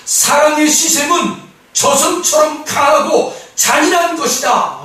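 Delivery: loud, high, slow verse proclamation; slow, ringing, high declaration; forceful proclamation. Real, not acted. Verse proclaimed, then restated quietly.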